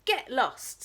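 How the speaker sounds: loud snooty dismissal; a spoiled princess snaps; rude brush-off. Acted. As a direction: shoo him away rudely. Princess rudely refuses.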